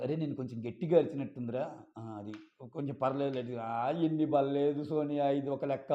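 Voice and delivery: low, soft backing-down; imitated sheepish making-up. Contestant smooths things over after yelling, imitated sheepishness. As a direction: play down the outburst in a small low voice.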